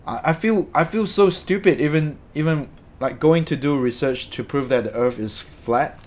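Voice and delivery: ordinary talk, nothing marked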